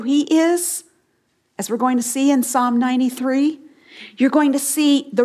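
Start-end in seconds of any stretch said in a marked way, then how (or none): none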